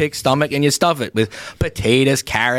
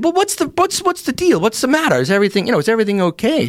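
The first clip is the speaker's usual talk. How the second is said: high-pitched, stumbling worried questions; mock worry, voice pitched up; anxious questioning; 7 semitones higher than usual. Lodge secretary asks the Red Cross lady what's wrong, anxiously.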